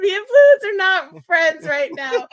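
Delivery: high, loud laughing complaint. Real, not acted. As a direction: laugh through the line. Laughing through throat trouble.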